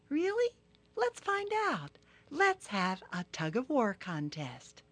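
high, needling turtle voice; sly Turtle. Acted. Turtle goading Rhino about his naps, high and sly. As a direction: tease him slyly, high.

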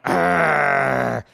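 loud, mock-scary bear roar; playful storybook bear growl; bears' growl. A narrator's reading. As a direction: growl to scare the girl. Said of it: "they all went".

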